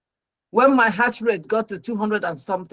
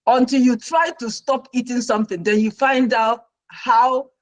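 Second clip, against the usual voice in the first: normal talking voice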